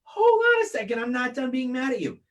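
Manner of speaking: hammed-up indignant rant; mock angry. Mock scolding his cohost.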